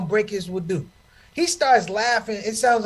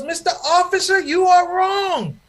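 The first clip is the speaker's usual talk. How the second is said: little daughter; 10 semitones higher, 3 dB louder than usual. Retold or imitated. Comic voices his daughter scolding the police officer. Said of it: "She goes".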